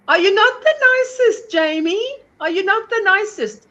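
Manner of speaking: gushing. Real, not acted. Fond praise of a friend.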